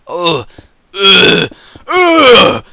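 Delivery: escalating Scottish-style grunts; Scottish plan sound. Shown as a demonstration. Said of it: "that's my Scottish plan sound".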